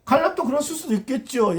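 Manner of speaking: ordinary delivery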